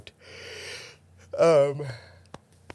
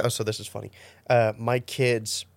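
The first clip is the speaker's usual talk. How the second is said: usual speaking voice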